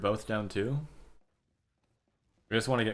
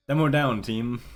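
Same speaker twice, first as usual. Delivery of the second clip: urgent callout; 3 semitones higher, 6 dB louder than usual. Retold or imitated. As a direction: bark it like a live team call. Mimicking in-game callout.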